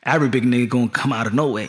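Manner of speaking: normal talking voice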